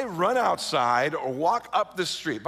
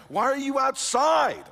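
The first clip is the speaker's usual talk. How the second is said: incredulous; 9 semitones higher, 4 dB louder, slower than usual. Real, not acted. Mocking his younger self.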